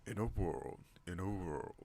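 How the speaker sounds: slow, dramatic trailer voiceover with swooping stress; movie trailer narrator. Acted. Vlogger trying out a film trailer line, mock-epic announcer voice.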